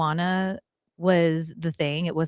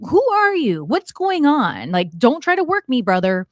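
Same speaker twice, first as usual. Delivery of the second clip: high-pitched, louder sceptical needling; retold playful challenge; 6 semitones higher, 5 dB louder than usual. Woman sizing up a mysterious stranger at the bar, retold challenge. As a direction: challenge him without real aggression, pitch leaping up then settling. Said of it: "I remember just challenging him, not in an aggressive way".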